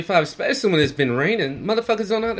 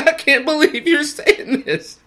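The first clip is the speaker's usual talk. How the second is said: higher, laughing in disbelief; 9 semitones higher, 4 dB louder, slower than usual. Retold or imitated. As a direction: laugh through the line at her claim. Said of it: "I started laughing".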